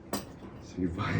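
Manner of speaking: ordinary delivery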